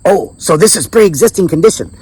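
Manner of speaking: put-on high-pitched Filipino accent, pouncing; mimicked Filipino accent. Doctor trying to catch out patient, mimicked Filipino accent. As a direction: imitate a Filipina doctor playing hard case. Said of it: "I'm doing my best to mimic it".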